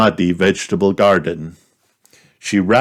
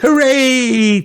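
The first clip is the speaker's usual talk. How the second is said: much higher and louder joyful cheer; 5 dB louder than usual. A narrator's reading. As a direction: Peppa seeing the muddiest puddles. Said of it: "cheered Peppa".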